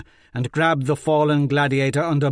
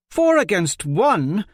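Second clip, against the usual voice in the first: slow, higher, louder outcry; shouted protest; 7 semitones higher, 5 dB louder, slower than usual. A narrator's reading. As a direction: cry out against the unfair odds. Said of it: "Jondralin shouted".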